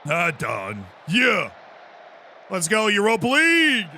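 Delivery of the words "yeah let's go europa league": short loud cheer of joy; excited shout. Real, not acted. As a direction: bark out a happy yeah. Celebrating in the game.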